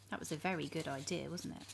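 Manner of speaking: hushed aside; soft aside. A narrator's reading. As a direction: confide it to the listener. Narrator aside to children.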